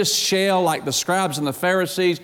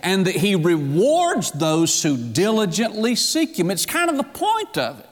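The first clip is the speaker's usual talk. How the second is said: higher, driving pulpit voice; sermon climbing toward fervor; building preacher fervor; 3 semitones higher than usual. Acted. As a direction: lift into full preaching. Rising preaching passage.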